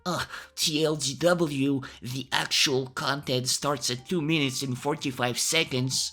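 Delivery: hammed-up online complainer; grumbling commenter. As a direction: put on the voice of an irritated viewer complaining. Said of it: "some people telling me".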